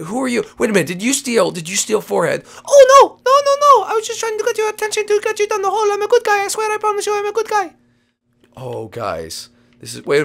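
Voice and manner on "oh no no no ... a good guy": very high, rushed rabbit voice; pleading rabbit. Pretend. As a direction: plead innocence fast in a tiny high voice.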